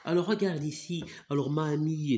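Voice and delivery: usual speaking voice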